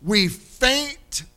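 high, loud, drawn-out preacher's cadence; preaching at full force. Acted. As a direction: preach it hard to the congregation, stretching the key words. Preaching the scripture passage.

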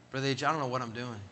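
low, soft and slightly hurried admission; subdued confession. Retold or imitated. Young man phones his pastor for help, subdued. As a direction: confess it quietly, as if lost.

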